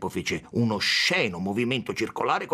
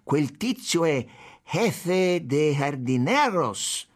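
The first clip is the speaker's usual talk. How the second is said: slow, higher, awed announcement; reverential awe; 5 semitones higher, much slower than usual. A narrator's reading. Policeman introducing the chief of gardens, reverential awe. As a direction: draw the title out with fearful respect. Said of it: "un certo timore reverenziale nel suo tono".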